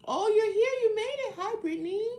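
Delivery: high, loud, delighted welcome; thrilled to see a friend arrive; excited greeting. Real, not acted. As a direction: burst out high, then stretch the last words. Greets viewer who just arrived.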